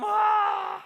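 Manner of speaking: very high, very loud imitated baby wail; imitated wailing cry. Baby wailing after the box is taken, imitated wailing cry. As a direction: wail like a baby in total despair. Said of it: "고개를 파묻고 울더라고요".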